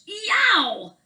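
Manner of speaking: high, loud cry of pain; hurt yelp inside the rolling log; sharp high squeal. Acted. Possum hits thorn bush.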